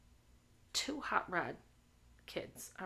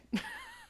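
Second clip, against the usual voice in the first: squeal laugh. Real, not acted. Laughs at chat.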